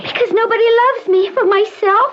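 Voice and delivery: put-on high, small voice of a withdrawn young woman; frightened girl. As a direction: push help away like a scared child. Patient laments.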